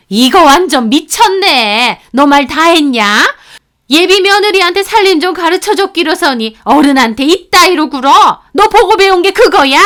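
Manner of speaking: loud, high outraged scolding; furious shout. Acted. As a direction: tear into her like an insulted elder. Mother-in-law yelling back. (격분한 예비 시어머니의 호통)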